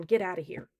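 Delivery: usual speaking voice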